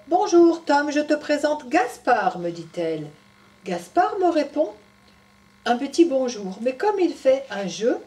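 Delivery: put-on lively storytelling voice for children; story narration. A narrator's reading. Storyteller telling Tom's story to children, animated. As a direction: read it to little ones.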